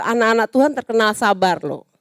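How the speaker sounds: ordinary talk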